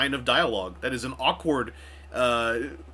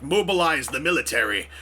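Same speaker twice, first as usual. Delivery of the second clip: a hint of a commanding actor; mock urgent order, lightly performed; lightly acted quote. Anthony Hopkins' character demanding action from the Prime Minister, mock order.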